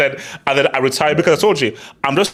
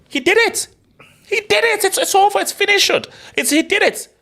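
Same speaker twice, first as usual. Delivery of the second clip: much higher, triumphant disbelief; ecstatic. Real, not acted. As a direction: shout it like the final whistle just blew. Celebrating that Messi won.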